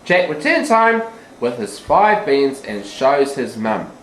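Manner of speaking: usual speaking voice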